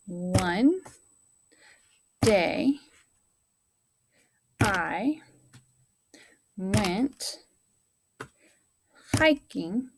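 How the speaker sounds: one word at a time, long pauses between; word-by-word dictation for young pupils; pounding out each word. Shown as a demonstration. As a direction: tap out every word slowly like a drum beat. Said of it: "So we're gonna pound out".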